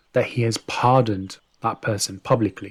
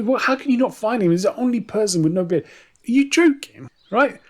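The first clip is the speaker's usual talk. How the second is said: a ruler who can't believe his guards failed; outraged disbelief; 12 semitones higher, 4 dB louder than usual. Acted. Ruler yelling at his guards, shrill with outraged disbelief. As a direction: pitch shoots way up, loud and incredulous.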